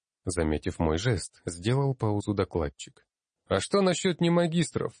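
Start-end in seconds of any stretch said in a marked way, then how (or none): 3.5-4.9 high, insistent challenge from the floor; raised voice. A narrator's reading. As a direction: speak up sharply with a much higher pitch. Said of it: "повысив голос".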